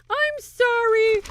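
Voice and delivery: very high, drawn-out, loud "sorry"; high-pitched wailing apology; squealed apology. Real, not acted. Breaking off with mock apology.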